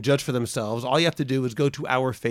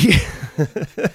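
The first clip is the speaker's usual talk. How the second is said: laughing; 3 semitones higher, 3 dB louder than usual. Real, not acted. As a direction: burst of laughter. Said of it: "[name], please stop laughing".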